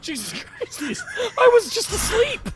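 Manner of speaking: put-on very high, frightened kid voice; a child jolted awake; startled child.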